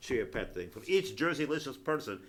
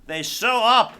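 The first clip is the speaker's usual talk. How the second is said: mock alarm; 5 semitones higher, 9 dB louder than usual.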